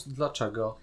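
ordinary talk

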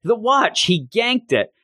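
high-pitched mock yell; Iron Mouse yelling. Iron Mouse as the watch is grabbed, mock yelling. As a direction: cry it out high, without much extra volume. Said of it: "having Iron Mouse yelling".

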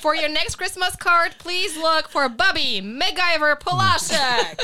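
high, loud rallying shout; excited proclamation. Real, not acted. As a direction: proclaim it to the whole crowd, stretching the name. Hyping Bobby to the audience.

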